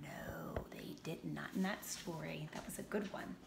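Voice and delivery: soft whisper to son; quiet aside to Declan. Real, not acted. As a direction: whisper reply. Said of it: "whispering to child".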